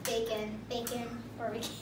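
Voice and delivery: barely audible mumble; very quiet. Real, not acted. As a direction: say it almost under your breath. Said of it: "Say it louder".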